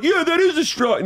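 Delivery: put-on high-pitched kid cheering a strike; excited little kid. Imagining how a kid would react at the game, excited little kid. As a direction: pitch it way up like an overexcited child. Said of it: "a little kid should be like".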